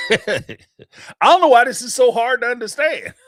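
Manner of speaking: exasperated laughing yell; laughing shout. Real, not acted. Laughing outburst.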